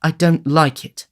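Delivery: put-on slow, small timid boy. A narrator's reading. Oliver refusing the thieves' offer, timid and slow. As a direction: shrink back as you say it. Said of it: "rejoined Oliver timidly".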